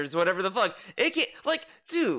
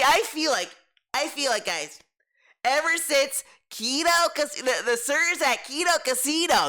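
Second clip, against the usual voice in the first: hyped DSB podcast rant; 5 semitones higher than usual. Retold or imitated. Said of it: "this will go on his little fucking dumbass podcast".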